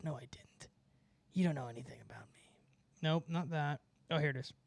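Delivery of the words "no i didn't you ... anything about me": fast whispered denial; whispering. Acted. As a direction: hiss it back under your breath. Defensive whispered retort.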